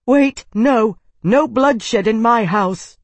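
high, loud command, slowing at the end; shouting. A narrator's reading. Isana forbidding bloodshed in her house, loud. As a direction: forbid the fight at full voice.

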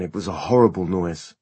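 plain reading voice

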